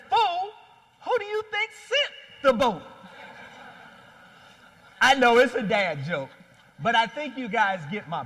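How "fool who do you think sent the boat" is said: high, scornful put-down with attitude; God snapping back at the drowned man, pitched up high; sassy God. Acted. God answers the angry man with sassy scorn. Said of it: "God looks back with an attitude".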